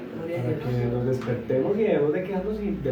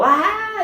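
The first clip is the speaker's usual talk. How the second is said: mock tantrum cry; 5 dB louder than usual. Child's tantrum acted out.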